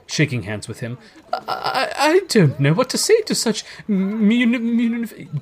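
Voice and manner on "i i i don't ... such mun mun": high, stuttering, overwhelmed character voice; flustered stammer. A narrator's reading. Gentleman overwhelmed by Scrooge's gift, stammering. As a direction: stumble and stick on the words, speechless.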